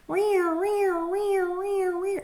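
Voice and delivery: high alarm whoop repeated; imitated alarm. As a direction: mimic the fire door alarm blaring.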